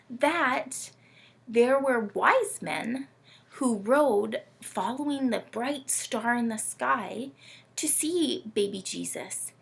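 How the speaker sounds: normal talking voice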